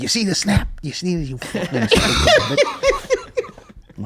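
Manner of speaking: high, slow jeering Italian voice; mock Italian husband. Husband mocking his wife about the snap, high slow jeer. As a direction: rub it in like a cocky barman. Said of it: "he's like, he's like".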